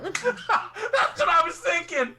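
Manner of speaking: high laughing voice. Real, not acted. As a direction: talk through real laughter. Laughing after the session ends.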